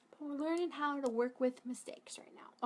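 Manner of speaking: soft whispered aside. Real, not acted. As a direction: breathe it out under your breath. Whispered aside over a mistake.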